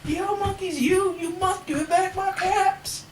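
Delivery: very high, drawn-out mock-furious cry; hammed-up storybook tantrum, stretching every word; angry shout. A narrator's reading. Very angry peddler stamping both feet, hammed-up angry shout. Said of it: "stamped both of his feet and shouted".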